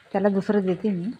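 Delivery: ordinary talk, nothing marked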